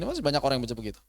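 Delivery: normal talking voice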